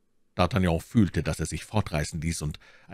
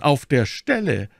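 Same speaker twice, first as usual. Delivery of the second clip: higher, eager exclamation; eager call; 8 semitones higher, 5 dB louder than usual. A narrator's reading. Milady longs for instant revenge, eagerly exclaiming. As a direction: call it out eagerly, pitch raised. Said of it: "Milady wollte rufen".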